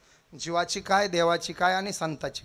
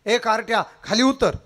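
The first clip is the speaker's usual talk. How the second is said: scolding shout; 4 semitones higher, 7 dB louder than usual. Acted. Guru runs out, shouts.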